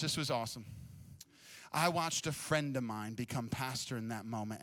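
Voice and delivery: normal talking voice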